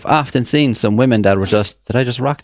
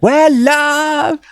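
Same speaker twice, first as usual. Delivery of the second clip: very high mock female greeting; brash townie woman; 4 dB louder than usual. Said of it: "when you see a female in town".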